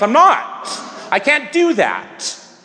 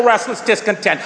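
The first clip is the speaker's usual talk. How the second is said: normal talking voice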